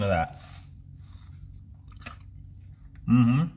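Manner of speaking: chewing while talking; talking with food in mouth; mouth full. Real, not acted. Seen 1: bites crinkle fry, fry visible in mouth while talking.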